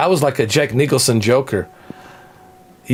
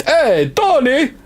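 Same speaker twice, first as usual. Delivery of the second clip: drawn-out high call, Italian-American mobster; mock Italian-American; 10 semitones higher, slower than usual. Wiseguy greets his pal Tony across the street.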